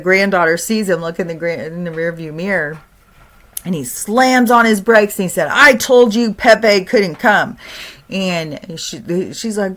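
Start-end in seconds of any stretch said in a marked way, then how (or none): 5.5-7.6 loud, slow, higher scolding; angry grandpa scold. Retold or imitated. Grandpa scolding granddaughter after slamming brakes, loud and slow. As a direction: bark it at the back seat, slowly.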